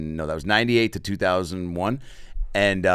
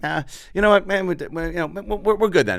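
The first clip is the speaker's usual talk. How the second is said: raised pitch, faltering teen voice imitating himself; mock higher voice; 11 semitones higher than usual. Son turning down the salesman, high mock voice. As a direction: play his younger self stammering, higher.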